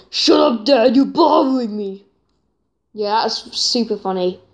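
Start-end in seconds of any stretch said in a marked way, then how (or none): 0.1-2.0 mock complaint, imitating a kid in a rage video; quoting the final scene. Viewer quoting an annoyed kid to Dad, mock complaint. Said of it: "I love the final scene".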